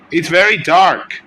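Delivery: loud exclamation; loud exclaim. Real, not acted. Repeated louder and higher.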